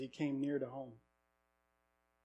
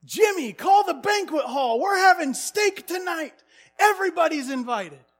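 much higher, louder celebratory shouts; jubilant yelling; 12 semitones higher, 11 dB louder, slower than usual. Retold or imitated. Brother yelling out the car window to the town, jubilant shouting. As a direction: shout the good news to the whole town. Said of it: "yelled out the window".

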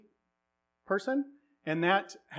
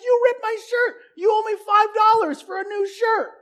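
much higher, shouting, near tears; kid yelling demand; 9 dB louder than usual. Retold or imitated. Rusty demanding five dollars from Jake, crying shout. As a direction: demand the money like a wailing kid. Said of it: "He's like".

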